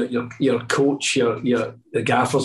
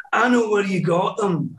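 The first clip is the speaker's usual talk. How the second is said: lightly imitated comedian, pitched higher; Billy Connolly; 6 semitones higher than usual. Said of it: "it's Billy Connolly".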